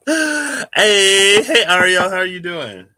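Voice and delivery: high, loud, bright hello; excited greeting. Real, not acted. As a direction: call out happily to a friend. Greeting a viewer by name.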